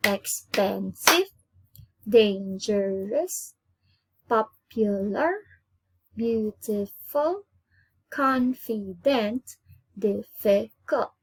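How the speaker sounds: slow, each word drawn out with a rising end; slow word list. Shown as a demonstration. Teacher reviewing three-syllable words, slow and deliberate. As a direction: read the list slowly for the class to follow. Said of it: "All of them has three syllables".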